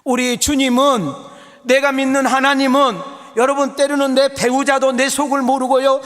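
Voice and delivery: loud, high, driving sermon delivery; impassioned preaching. Real, not acted. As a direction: preach at full force to the congregation. Preaches at full force.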